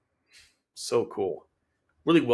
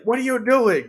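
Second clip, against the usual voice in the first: high, louder, incredulous yelp; mock indignation; 11 semitones higher, 5 dB louder than usual. Ashley to Jean who just shot at him, mock indignant. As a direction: imitate someone startled and protesting. Acted comedy quarrel after shot.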